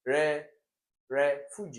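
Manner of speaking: refugee broken up, RE punched loud; wrong stress on RE. Shown as a demonstration. Teacher testing a wrong option, loud stress on re in refuge. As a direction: hit the first syllable RE hard, then let fuge fall. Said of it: "If you put it on this".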